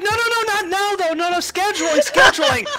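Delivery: very high frantic no-no; high panicked fussing; flustered organizer. Acted. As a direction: fuss about the schedule. GM voices flustered coordinator.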